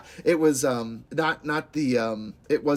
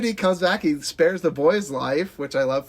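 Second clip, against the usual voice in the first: normal talking voice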